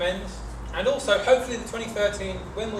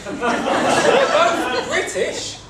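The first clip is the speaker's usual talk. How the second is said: laughing; 6 semitones higher, 8 dB louder, much slower than usual. Real, not acted. Laughing through the joke.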